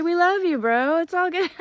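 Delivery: high loud friendly cheer. Retold or imitated. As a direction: cheer a passing driver kindly. The entire crowd's reaction was just to start cheering.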